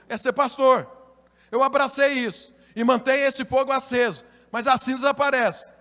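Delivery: loud, high sermon declaration; preaching at full force. Acted. Pastor declaring his calling loudly to the church. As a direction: proclaim it to the brothers.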